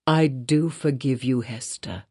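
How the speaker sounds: low, soft, heavy forgiveness; the minister forgiving Hester without anger; deep sadness. A narrator's reading. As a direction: forgive her from the depth of sorrow. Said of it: "with a deep utterance, out of an abyss of sadness".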